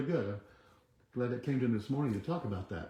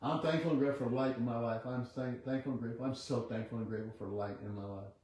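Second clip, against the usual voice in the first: quiet affirmation; 6 dB quieter than usual. Acted. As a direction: murmur the affirmation like a meditation. Leads a chanted affirmation.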